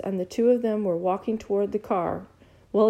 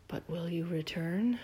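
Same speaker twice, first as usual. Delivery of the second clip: softer, lingering repeat of the question; soft echo; 3 semitones lower, 9 dB quieter than usual. A narrator's reading. Flora hears William's question echo in her mind, softly. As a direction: let it hang in the air quietly. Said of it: "words hanging in the air".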